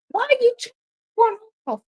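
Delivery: high, incredulous shout of protest; outraged disbelief. Real, not acted. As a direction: cry out at the decision in disbelief. Outraged at a disallowed goal.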